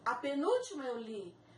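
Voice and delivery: ordinary delivery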